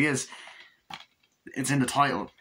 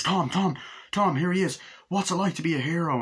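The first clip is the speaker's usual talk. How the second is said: higher, eager shouting of questions; paparazzi calling out; 7 semitones higher than usual. Retold or imitated. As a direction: pester him like a pushy reporter. Paparazzi swarm Tom.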